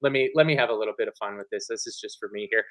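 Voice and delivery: fast talking. Real, not acted. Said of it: "get to talk really quickly".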